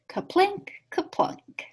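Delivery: usual speaking voice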